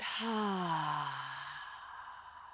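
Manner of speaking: long open-mouthed sigh out; audible sigh. Shown as a demonstration. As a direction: let a deep breath go on a sigh. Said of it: "sigh it out".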